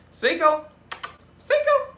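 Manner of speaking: high, loud call across the room; calling the cat. Real, not acted.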